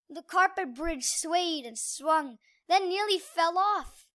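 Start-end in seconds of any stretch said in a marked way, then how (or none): none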